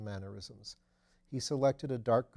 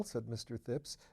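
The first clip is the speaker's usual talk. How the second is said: ordinary delivery, nothing marked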